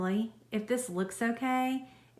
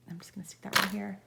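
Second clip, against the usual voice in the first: whispering; faster than usual. Real, not acted. Whispering while focusing on stitching.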